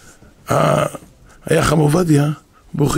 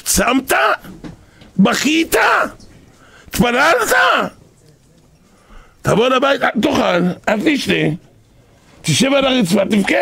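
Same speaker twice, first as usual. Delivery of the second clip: stern demands; 8 semitones higher than usual. Retold or imitated. Rosh yeshiva grilling the husband, stern and demanding. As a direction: press him hard, question after question.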